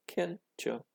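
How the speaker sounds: plain reading voice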